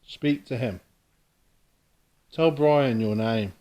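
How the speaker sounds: calling out. Real, not acted. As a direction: project your voice into the empty room. Calling out to a spirit.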